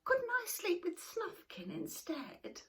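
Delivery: put-on high, slow, childlike complaint; young Moomin Troll. Moomin Troll asking his mother about bedtime, high childlike plea. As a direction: whine a little like a small troll. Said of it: "said Moomin Troll".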